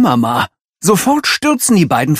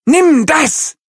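shouting; 5 dB louder than usual. Acted. Tafiti hurls the cake.

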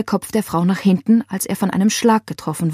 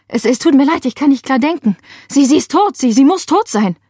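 high, loud, stammering panic; distraught; 6 semitones higher, 5 dB louder than usual. A narrator's reading. Laila telling the operator the woman is dead, distraught. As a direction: stammer it out, losing composure. Ich kann nicht klar denken.

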